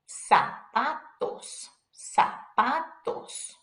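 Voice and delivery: slow "Zapatos" starting with an S. Shown as a demonstration. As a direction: say Zapatos as sa-pa-tos, syllable by syllable. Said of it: "sounds like an S".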